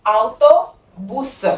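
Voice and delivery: ਆਟੋਬੁਸ said very slowly as ow-to-BOOS with a short u, rising; patient teacher spelling out ਆਟੋਬੁਸ; stretched Italian word. Shown as a demonstration. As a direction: draw out ਆਟੋਬੁਸ, oo not the English a of bus. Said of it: "ਇਹਨੂੰ ਕਹਿਣਾ ਬੁਸ".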